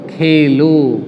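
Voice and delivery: Khelo alone, drawn out, low and falling; Khelo set apart. Shown as a demonstration. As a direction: land on Khelo as a separate command. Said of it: "क्या करो?". (खेलो अलग से, खींचकर, नीचे स्वर में)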